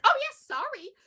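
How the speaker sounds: high-pitched "whoops" imitation; mock sheepish apology. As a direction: start loud and high, then drop. Agent's sheepish reply, retold.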